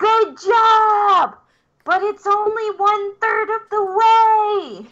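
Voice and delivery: high-pitched shout to someone far below; yelling down. Acted. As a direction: holler it down the drop, drawn out. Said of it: "yell down to Kellen".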